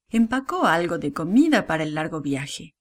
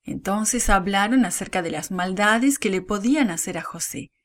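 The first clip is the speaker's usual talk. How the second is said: ordinary delivery, nothing marked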